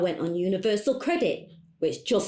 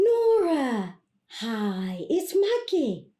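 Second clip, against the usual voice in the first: high, sing-song phone hello; put-on cheery greeting; 5 semitones higher, much slower than usual. Client leaving message for coach, falsely cheery. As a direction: greet sweetly to cover the annoyance.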